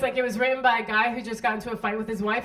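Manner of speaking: usual speaking voice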